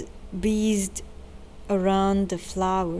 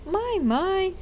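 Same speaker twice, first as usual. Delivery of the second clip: much higher, bursting with excitement; exploding with excitement; 11 semitones higher than usual. A narrator's reading. Tick delighted by the boat plan, bursting out. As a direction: let it burst out. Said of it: "exploded Tick".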